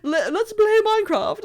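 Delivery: high, loud hammed-up posh English chatter with a laugh; mocking posh English. Mocking a posh Minecraft streamer's fake English voice, high and loud. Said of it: "his whole thing was just speaking".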